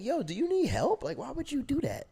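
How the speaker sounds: very high, baffled concern; genuinely concerned. Retold or imitated. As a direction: act out a worried, naive friend. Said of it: "I'm genuinely concerned".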